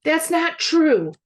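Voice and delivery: high, loud drawn-out yell; slow yell. A narrator's reading. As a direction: yell it as you run back in.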